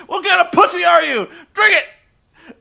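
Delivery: high, loud wrestler goading a pal; hammed-up yelled demand; mock bullying shout. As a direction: holler it as an over-the-top taunt. Acted bullying in retold exchange.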